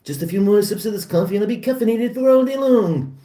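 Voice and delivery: high-pitched cartoon impersonation; cartoon character voice. More cartoon impersonation after Simpsons scene.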